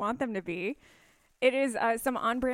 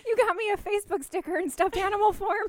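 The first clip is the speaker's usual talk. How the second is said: delighted squeal; 10 semitones higher, 5 dB louder than usual. Real, not acted. Opening a gift, delighted.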